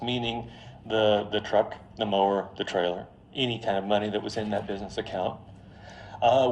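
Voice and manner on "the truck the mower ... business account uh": usual speaking voice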